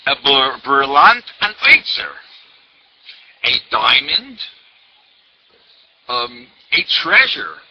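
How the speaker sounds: loud, slow, emphatic pronouncement; quoting the Rebbe. Retold or imitated. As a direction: deliver it like a weighty blessing. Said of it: "the Rebbe said in French".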